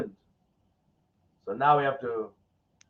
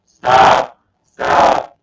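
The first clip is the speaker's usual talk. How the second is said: shouting; 11 dB louder than usual. Real, not acted. Slapped around, yelling stop.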